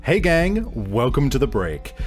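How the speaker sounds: usual speaking voice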